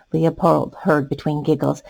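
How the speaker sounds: plain reading voice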